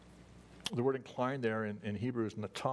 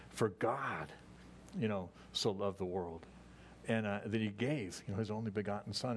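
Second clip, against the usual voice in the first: slow, savoring read. Shown as a demonstration. Pastor rereading the verse slowly to digest every word, unhurried. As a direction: let each word sink in. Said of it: "read it slowly and just kinda, like, digest every word".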